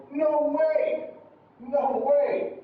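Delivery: "No way!" high from start to finish; high intonation. Shown as a demonstration. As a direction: keep both words of "No way!" high. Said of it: "It's just high".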